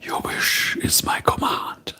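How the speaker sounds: breathy whispered genie line, soft and low; whispering genie. Acted. A streamer voicing the lamp genie to chat, whispered. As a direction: hush it like a genie granting a wish from deep inside his lamp.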